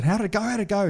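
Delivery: high-pitched, excited asking; retold excited phone question; eager questioning. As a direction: ask eagerly, pitched well up. Eager call after the show.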